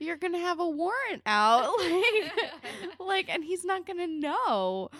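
high, loud mock protest; exasperated scolding. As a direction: argue it loudly, incredulous and half laughing. Acted scolding continues, louder.

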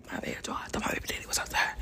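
real hushed, hurried whisper; whispered aside. Real, not acted. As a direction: drop to a quick apologetic whisper. Breaks off for an aside.

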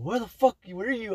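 high-pitched mock scolding; retold parental outburst, high and sharp; imitated angry mom. Acts out mom demanding whereabouts.

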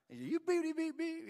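high mock bleeps over a kid's swearing; censored cussing. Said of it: "but one of them, like".

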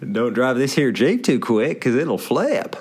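slow, lightly voiced folksy miner; old miner drawl. Friend reading the Jeep sticker as a folksy old miner. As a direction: read the sticker like an old prospector, slowly.

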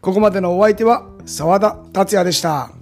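upbeat teaser narration; punchy variety show voiceover. Acted. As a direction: sell the next episode. (バラエティ番組風のナレーション)

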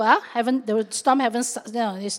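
ordinary talk

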